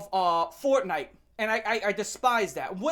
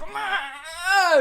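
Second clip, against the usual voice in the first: high, loud laughing shriek; mock tantrum shriek; 8 semitones higher, 6 dB louder than usual. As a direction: shriek like an office worker losing it. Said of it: "just throwing this tantrum".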